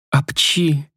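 high comic sneeze. Acted. Sneeze mid-scene.

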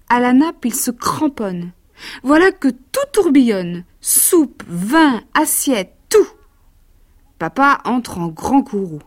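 put-on lively verse read to a child; lecture de conte. A narrator's reading. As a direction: read it like a bedtime picture book. Reads a children's verse tale. (lecture animée de conte pour enfants)

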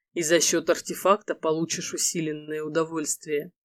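low, soft husky male lead. Acted. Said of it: "говорил хрипловатым голосом".

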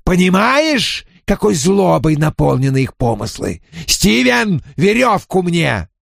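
high furious ranting; furious command. Acted. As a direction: bark for the rope in rage. Spirit rants, orders rope.

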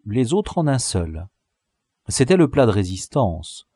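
usual speaking voice

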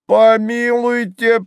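high, loud, drawn-out protest; stretched indignant exclamation; exclaiming. A narrator's reading. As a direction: cry out slowly, high and scandalized. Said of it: "воскликнул дурак".

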